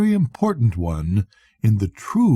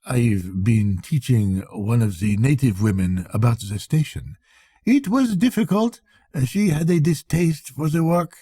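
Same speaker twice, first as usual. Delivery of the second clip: a prim clerk modestly explaining his linen; modest accountant; 2 semitones higher than usual. Acted. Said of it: "said modestly".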